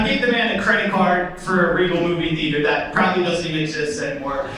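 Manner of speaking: usual speaking voice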